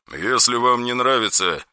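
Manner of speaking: soft, slow, icy and unmoved; ice-cold tone. Acted. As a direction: chill him with every word. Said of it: "совсем ледяным тоном".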